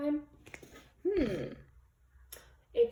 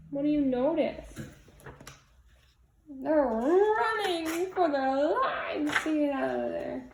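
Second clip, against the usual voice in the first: ordinary delivery, nothing marked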